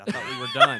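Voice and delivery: laughing through the words. Real, not acted. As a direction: laugh it out in disbelief. Laughing disbelief at five movies.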